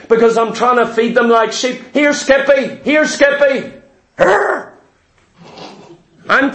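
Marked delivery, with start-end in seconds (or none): 1.9-3.7 calling a dog. Retold or imitated. 4.2-4.7 high mock growl of a biting dog; dog snarl. Dog attacks him.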